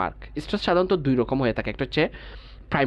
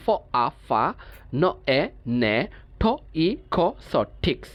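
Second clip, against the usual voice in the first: broken into parts; much slower than usual. Shown as a demonstration. Teacher breaking a word into syllables for students, part by part. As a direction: sound out each piece separately. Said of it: "part part করে উচ্চারণ করবো".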